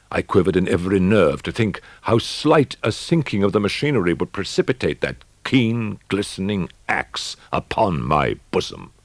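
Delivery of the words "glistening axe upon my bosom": ordinary delivery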